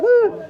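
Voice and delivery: a high, squealed ooh; girl's high ooh. Retold or imitated. Meme girl after the breakup, squealing ooh. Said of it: "and the girl's like".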